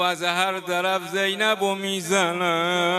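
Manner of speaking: half-chant the grief at full force; wailing lament preacher. Acted. Preacher laments Zeynab and bound children, wailing.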